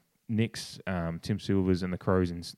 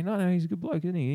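high-pitched, insistent mock questioning; Rowie; 10 semitones higher than usual. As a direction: imitate someone pushing for agreement. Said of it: "Rowie just kept on trying to say".

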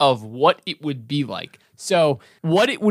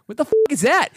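high-pitched mock bafflement; exasperated, high rising question; incredulous; 11 semitones higher, much faster than usual. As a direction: play a listener totally lost. Acted baffled listener to Bill.

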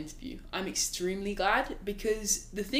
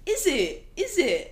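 imitating a friend; 8 semitones higher, much slower than usual. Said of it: "Raph says this a lot".

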